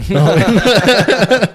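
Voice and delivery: slow, high, laughing through the words; real laugh, dragging the line out. Real, not acted. Laughing to his mate about drinking.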